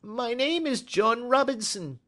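very high, furtive little-man voice; evasive little man. A narrator's reading. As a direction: answer evasively in a high-pitched voice. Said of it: "he answered with a sidelong glance".

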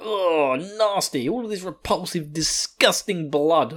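mock revulsion at a foul taste; disgusted. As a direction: act out a grossed-out monster. Bat demon disgusted by the taste.

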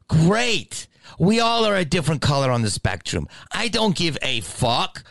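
high, sarcastic outburst; losing it. Retold or imitated. Answering a mother's spectrum excuse, losing it. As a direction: retell a fed-up rant at high pitch. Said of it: "and then I just lost it".

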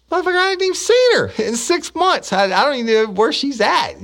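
high, loud squeaky outburst; mock outraged yelp; outraged retort. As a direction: blurt it out in disbelief. Retold outraged reply to supervisor.

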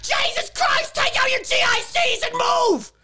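high, screaming in mock panic; panicked scream. Shown as a demonstration. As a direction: shriek like a scared everyman. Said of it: "How's he gonna react to that?".

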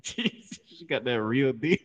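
slow, laughing through the words. Real, not acted. Host riffs on skinny woman, laughing. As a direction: barely get it out through laughter. Cracking up at own joke.

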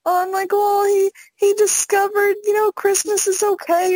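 high-pitched, put-on weepy voice; mock tearful. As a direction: plead it high, as if choking up. Acting out emotional reaction to Grinch.

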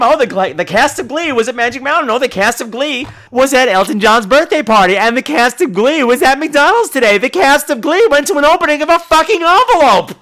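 sing-song, building exasperated parody; mocking gossip. Parroting reports about the cast everywhere, mocking and exasperated.

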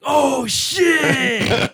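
high, loud surprised yelp; sudden loud realization that the dog answers to Grogu; startled shout. Retold or imitated. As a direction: jump at the realization. Reaction to dog responding to name.

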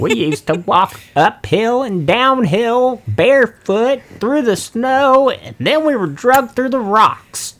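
put-on creaky high old-timer grumble; cranky old geezer, slow and high; old prospector. Old-timer griping at youngsters.

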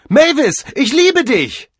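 high, full-volume declaration of love; desperate shout. Acted. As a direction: yell it after her as she leaves. Cordell shouts love after departing Mavis. (verzweifelt hinterhergeschrien)

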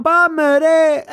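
loud, high war cry; rallying cry. Acted. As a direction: shout it to rally the troops. (πολεμική κραυγή)

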